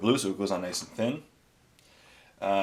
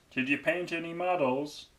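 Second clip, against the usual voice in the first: higher put-on voice of friend Andy; higher goofy friend voice; Andy impression; 9 semitones higher than usual. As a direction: do your buddy Andy's voice, pitched up. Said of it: "That's the way Andy sounds".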